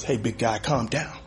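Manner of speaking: lightly voiced low detective, quiet; mock calm detective. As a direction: play the detective soothing him. Detective calming him.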